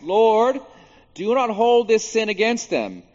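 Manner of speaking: loud, high cry of prayer; retold yell. Preacher repeating Stephen's prayer for those wounding him, yelled. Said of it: "And he yells out".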